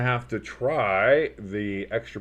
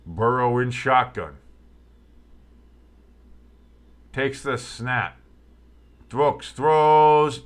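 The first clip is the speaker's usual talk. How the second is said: rising live sports commentary into the touchdown; play-by-play call; 5 dB louder, much slower than usual. Acted. Streamer calling the touchdown for his viewers, building excitement.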